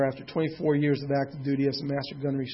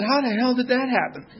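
high, louder disbelieving outburst; shocked outburst; 10 semitones higher, 5 dB louder than usual. Retold or imitated. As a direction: blurt it out in shock at the news. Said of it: "I said".

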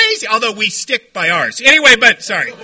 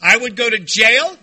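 ordinary delivery

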